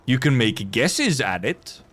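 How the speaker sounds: ordinary talk, nothing marked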